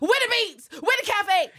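high, loud demand for missing food; retold scream. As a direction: yell each question in mock outrage. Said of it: "I did scream quite a bit".

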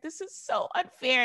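high whiny complaint; mother whining at the park; whining mom. Retold or imitated. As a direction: moan it like a fed-up parent. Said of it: "she's like".

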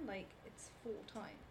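whispered. Retold or imitated. Embarrassed inner thought.

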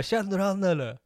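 put-on insistent questioning; agitated question. Repeat of retold agitated question.

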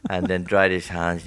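ordinary talk

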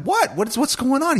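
high, alarmed what, what's going on; startled alarm. Retold or imitated. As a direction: jump up into a panicked question. Mom startled by crying son.

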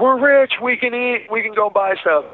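thrilled, high-pitched shout of joy; overjoyed, voice leaping up; excited. Retold or imitated. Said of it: "and I told him".

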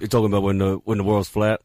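deep, low athlete impression; Shaquille O'Neal. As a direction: keep talking in a put-on deep voice. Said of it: "like this Shaquille O'Neal".

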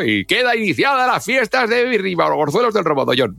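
high, grand festival proclamation from a balcony; pregón de fiestas. Pretend. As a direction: announce the village fiesta like the town crier. Pregón desde el balcón del pueblo. (pregonero solemne de fiestas patronales)

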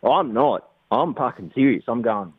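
ordinary talk, nothing marked

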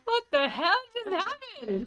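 high, slow disbelief; stunned. Retold or imitated. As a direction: replay being blindsided on the phone. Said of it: "screamed at me on the train".

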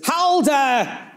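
sudden high barked call of a name; retold shout. Hitler calling his general down the corridor, high sharp shout. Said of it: "he shouts for him".

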